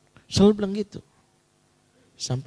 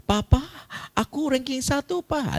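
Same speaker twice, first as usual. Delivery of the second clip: put-on much higher, eager child's voice; excited child. Child announcing top rank to Papa, high and excited. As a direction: call out to Papa in a high, proud voice. Child proudly reports to father.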